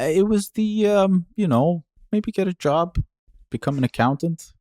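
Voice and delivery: high-voiced mock career advice; imitated family advice. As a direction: suggest it in a high put-on voice.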